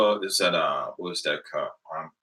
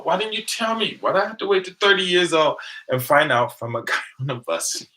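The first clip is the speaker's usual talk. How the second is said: much higher, exasperated questions; indignant disbelief; 9 semitones higher, 6 dB louder than usual. Retold or imitated. Asking mom why she never told him, in mock outrage. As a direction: act amazed and a bit betrayed.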